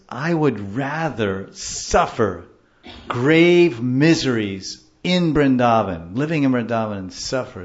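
loud, slow, drawn-out vow; emphatic declaration. Retold or imitated. Teacher voicing the author's preference for Vrindavan, loud and emphatic. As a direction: declare it loudly, stretching the key words.

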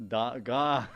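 loud, laughing gone. Real, not acted. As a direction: burst out with it through laughter. Laughing after arrow strikes.

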